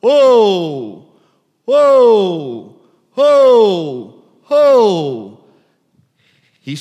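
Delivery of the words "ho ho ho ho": loud, high calling cry to the flock; repeated loud herding call; shepherd's loud call. Retold or imitated. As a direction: call the sheep out loud across the fold. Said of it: "starts crying out loud".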